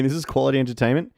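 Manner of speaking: usual speaking voice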